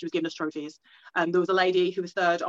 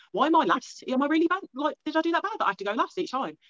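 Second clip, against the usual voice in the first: higher-pitched fretting, imitating past self; worried self-questioning; 9 semitones higher than usual. Competitor doubting herself at first contest, retold worried questioning. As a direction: replay your worried doubts in a raised pitch.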